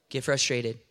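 ordinary talk

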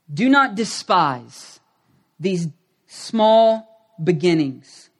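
very slow, deliberate verse reading; measured pulpit reading with long stressed words; scripture reading. Acted. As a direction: proclaim the verse phrase by phrase, with weight. Reads a Bible verse aloud.

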